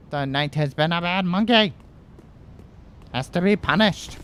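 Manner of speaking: high, hammed-up telling-off; mock scolding. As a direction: pronounce a playful sentence on the culprit. Mock scolding a game enemy.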